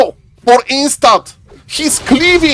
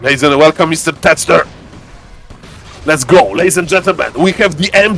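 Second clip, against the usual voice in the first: deep put-on voice; 6 semitones lower than usual. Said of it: "talk like this".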